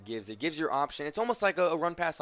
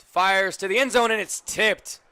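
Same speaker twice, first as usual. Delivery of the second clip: loud, high burst on the tipped pass; play-by-play jumping up in excitement; excited call; 6 semitones higher, 8 dB louder than usual. Real, not acted.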